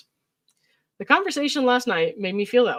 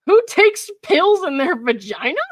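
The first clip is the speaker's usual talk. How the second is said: mock incredulous; 9 semitones higher, 7 dB louder, slower than usual. Reading her texts in disbelief.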